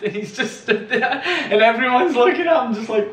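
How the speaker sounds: can't hold back the laughter while telling it; laughing. Real, not acted. Telling a friend the ping pong story, laughing hard.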